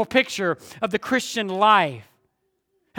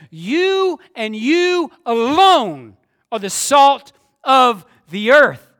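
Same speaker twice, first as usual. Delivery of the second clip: slow, heavy stress on you and you alone; emphatic translation; 4 dB louder, slower than usual. Shown as a demonstration. Preacher showing the congregation the Greek emphasis, slow and emphatic. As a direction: spell it out slowly so every you lands. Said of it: "the emphasis could be translated like this".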